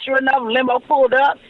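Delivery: usual speaking voice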